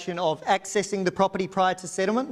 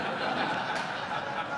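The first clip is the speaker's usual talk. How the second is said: soft laugh; 8 dB quieter than usual. Real, not acted. Auctioneer laughs at correction.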